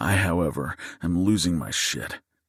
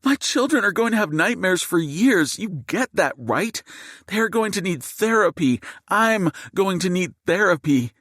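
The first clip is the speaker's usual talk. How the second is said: shouting; 12 semitones higher than usual. Acted. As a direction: yell it at the masked man in outrage. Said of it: "and she's shouting".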